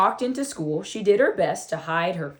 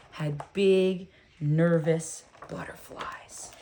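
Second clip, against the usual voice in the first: whisper; 4 semitones lower, 5 dB quieter, slower than usual. A narrator's reading. Narrator hushes.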